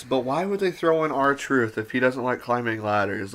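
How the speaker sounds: ordinary talk, nothing marked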